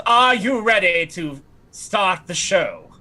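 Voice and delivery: high, slow, grand character voice; Lysinopril. Acted. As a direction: draw it out like a stage performer. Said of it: "So Lysinopril, she says".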